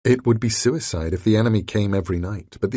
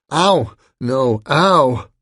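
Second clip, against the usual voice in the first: much higher, louder cries of pain; pained yelps; 5 semitones higher, 7 dB louder, much slower than usual. A narrator's reading. Grand Al bitten by the werewolf, crying out in pain. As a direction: yelp as the bite lands.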